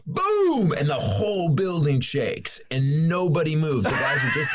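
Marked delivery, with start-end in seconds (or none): 0.1-0.7 a high, sharp "boom" sound; imitated explosion. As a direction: mimic the blast with a burst. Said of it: "we hear".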